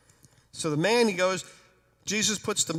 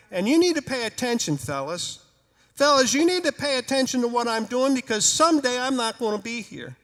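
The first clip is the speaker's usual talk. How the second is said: 1 urgent plea; 5 semitones higher, 5 dB louder than usual. Acted. Jesus urging disciples forcefully.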